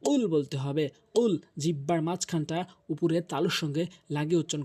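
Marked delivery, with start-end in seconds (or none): 0.0-1.3 কুল with q popped from the back; pushed deep q. Shown as a demonstration. Teacher gives the right word, back-tongue q কুল. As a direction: push the first sound of কুল off the palate. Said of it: "ধাক্কা দিয়ে".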